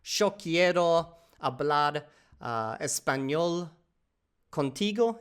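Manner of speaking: slow, halting beginner Spanish; slow learner Spanish. Shown as a demonstration. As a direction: sound it out slowly as a learner. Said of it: "the grammar and stuff started to come out".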